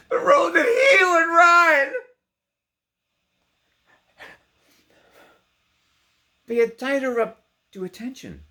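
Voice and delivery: loud, high, crying out; anguished cry. A narrator's reading. Narrator crying out as Bess sees the road, loud and anguished. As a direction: cry out the line in despair.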